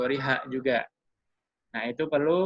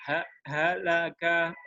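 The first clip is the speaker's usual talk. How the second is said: halaka read slowly with ha, la, ka all equal length; even syllables; 2 semitones higher, much slower than usual. Shown as a demonstration. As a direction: give each syllable of halaka one equal beat. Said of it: "ketukannya harus seragam". (halaka dibaca pelan, ha la ka sama panjang)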